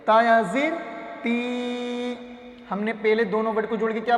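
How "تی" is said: تی with a long, held ee; drawn-out vowel. Shown as a demonstration. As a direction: stretch the ee of تی steadily. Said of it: "تا کو کھینچ کر پڑھیں گے". (تی کو کھینچ کر)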